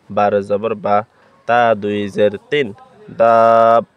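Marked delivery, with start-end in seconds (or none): 3.2-3.8 দাব with the vowel held long and louder; four-count madd. Shown as a demonstration. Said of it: "চার আলিফ টানি পড়তে হবে".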